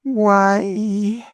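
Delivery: high, loud joyful why; surprised delight. A narrator's reading. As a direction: gasp it out, amazed and glad. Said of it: "with an accent of surprise and delight".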